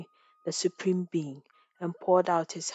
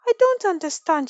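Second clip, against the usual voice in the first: stammering; 9 semitones higher, 6 dB louder than usual. A narrator's reading. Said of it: "she stammered".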